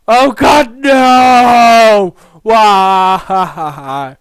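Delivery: loud despairing cry, drawn out; anguished wail. Acted. Game player cries out in mock despair, anguished wail. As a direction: wail it at full volume, as if doomed.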